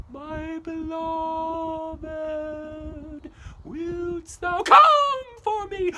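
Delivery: high, slow, loud wail; wailing ghost. Acted. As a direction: moan it like a ghost in the night.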